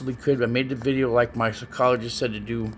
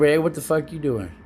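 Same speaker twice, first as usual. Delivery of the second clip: casual friendly demo; 4 semitones higher than usual. Shown as a demonstration. Showing Ella the same words meant harmlessly, casual and easy. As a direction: ask it as a harmless, easygoing question. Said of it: "Compared to ... See the difference?".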